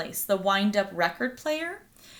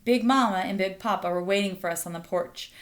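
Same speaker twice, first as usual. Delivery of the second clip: ordinary delivery, nothing marked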